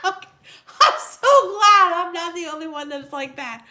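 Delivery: high, loud, slow gush through laughter; drawn-out, giggly wail of gladness; laughing relief. Real, not acted. Relieved laughter at viewer comment.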